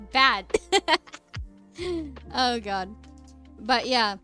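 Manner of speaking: ordinary talk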